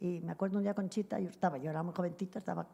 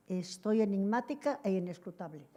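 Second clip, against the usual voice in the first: laughing through a quoted friend's tease; laughing quote. Retold or imitated. Recalling Conchita's joke, laughing while quoting her. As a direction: let the laugh break in as you quote it.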